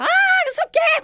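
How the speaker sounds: imitated high yell; mock shout. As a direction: shriek it like the protective dresser. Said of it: "começou a gritar".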